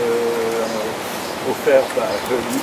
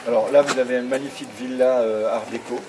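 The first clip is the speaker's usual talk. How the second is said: ordinary talk, nothing marked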